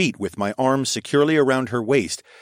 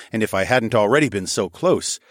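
plain reading voice

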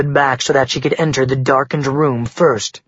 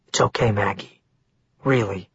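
low, soft, soothing murmur; calm them down quietly; gentle reassurance; 5 semitones lower, 5 dB quieter than usual. A narrator's reading. Wren soothing Maggie before he leaves, gentle reassurance.

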